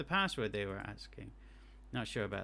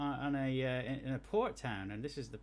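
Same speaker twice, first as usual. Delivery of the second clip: ordinary talk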